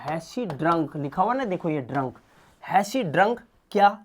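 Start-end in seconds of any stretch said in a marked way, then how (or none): none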